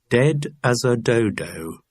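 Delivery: slow, rhythmic list entry; measured headword in a recited list; catalogue rhythm. A narrator's reading. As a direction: read it off like a catalogue. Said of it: "You're getting a rhythm to the words".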